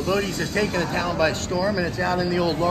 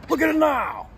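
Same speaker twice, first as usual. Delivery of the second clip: much higher, loud Pacino cry; Al Pacino impression; 10 semitones higher, 5 dB louder than usual. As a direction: shout it like Pacino. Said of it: "If I were Pacino".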